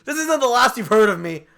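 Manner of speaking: high, loud parting threat; villain vow. Acted. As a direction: storm off vowing revenge. Contestant vows revenge leaving.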